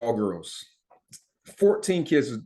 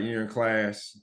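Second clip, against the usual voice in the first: normal talking voice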